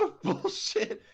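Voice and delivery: breaking into laughter, voice cracking high; laughing. Real, not acted. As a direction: laugh through the word bullshit. Laughing through the description.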